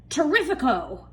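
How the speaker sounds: high, loud greedy exclamation; excited cry. A narrator's reading. Aunt Sponge delighting in the peach as a meal, excited cry. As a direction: relish it like a feast. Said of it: "Aunt Sponge cried out".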